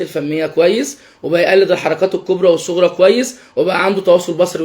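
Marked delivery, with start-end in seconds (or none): none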